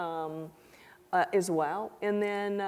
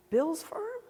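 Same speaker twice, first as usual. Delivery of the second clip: high surprised "Bill's firm?"; surprised question; 11 semitones higher than usual. Retold or imitated. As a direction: ask it in quick surprise. Prosecutor surprised.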